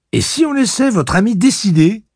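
plus haut et plus fort, bonne humeur; ton élevé enjoué. Acted. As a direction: hausse le ton gaiement. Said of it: "élevant le ton avec bonne humeur". (Cuthbert lance la question à Reynolds, ton élevé et enjoué)

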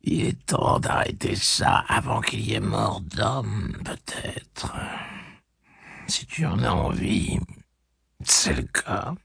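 plus doux, lent, pour soi; voix intérieure. Acted. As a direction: pense tout bas. Jonas se parle à lui-même. (Jonas se parle intérieurement, doux et lent)

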